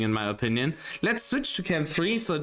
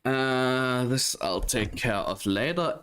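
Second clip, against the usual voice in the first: ordinary talk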